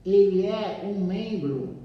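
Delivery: slow, measured reading with pauses between phrases; leitura de livro em voz alta. Acted. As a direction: read the text out deliberately, phrase by phrase. Reads the book aloud to listeners. (leitura pausada, frase por frase)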